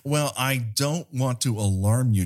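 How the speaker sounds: usual speaking voice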